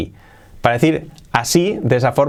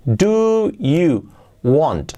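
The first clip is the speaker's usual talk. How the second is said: very slow "Do you want?" word by word; slow and careful; 4 semitones higher, much slower than usual. Shown as a demonstration. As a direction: draw out each word. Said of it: "que es un error típico". (muy lento, palabra por palabra)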